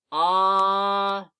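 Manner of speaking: "Ah" held long and loud; drawn-out letter sound. Shown as a demonstration. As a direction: sustain "Ah" on one level note. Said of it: "makes the ah sound".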